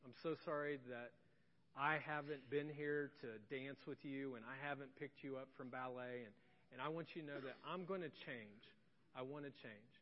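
soft, low, gentle apology; tender apology. Retold or imitated. Dad apologizing to his daughter, tender. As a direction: speak softly to a small child. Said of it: "I sat her down".

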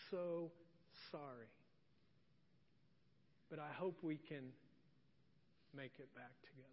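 soft, quiet retelling of a choked-up apology; tearful apology. Retold or imitated. Old friend apologizing to the pastor, quietly tearful. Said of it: "he's just bawling".

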